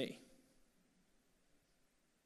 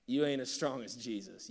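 ordinary talk, nothing marked

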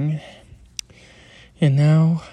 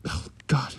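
choking. Acted. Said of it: "Started choking on the water".

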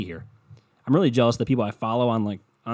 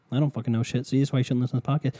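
usual speaking voice

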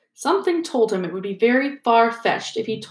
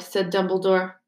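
ordinary delivery, nothing marked

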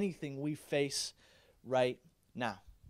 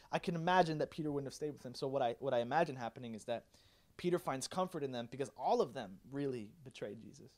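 ordinary talk

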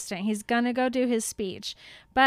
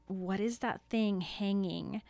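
hushed Ron; 3 semitones lower, 8 dB quieter than usual. Acted. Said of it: "Ron is like".

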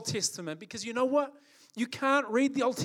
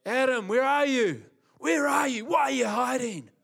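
loud, raised voice of God calling for Adam; 4 semitones higher, 5 dB louder than usual. Retold or imitated. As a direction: call out like a searching father. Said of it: "God like".

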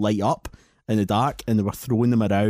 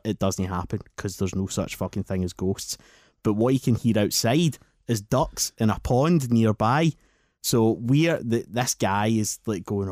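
usual speaking voice

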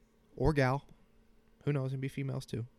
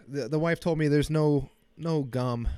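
ordinary talk, nothing marked

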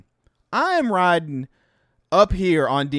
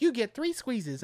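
put-on higher, slow and soft anime girl; coyly dole out a reward in a girly voice; cutesy girl voice; 8 semitones higher, 8 dB quieter than usual. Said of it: "and she's like".

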